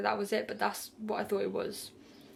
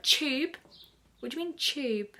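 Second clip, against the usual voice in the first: higher-pitched, puzzled questioning; retold disbelief, voice lifting on the question; baffled echo; 5 semitones higher than usual. Patient questioning the doctor about the tube, baffled.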